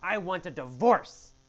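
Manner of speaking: loud, high, indignant outburst at her husband; righteous angry yell. Acted. Wife yelling at her husband Bob, furious and indignant. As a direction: hurl it at him like she has had enough. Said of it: "She yells at him with righteous indignation".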